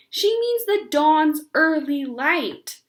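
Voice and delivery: mock-correct her word by word; exaggerated distinctness. Beezus correcting Ramona, each word exaggerated. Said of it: "pronounced each word with exaggerated distinctness".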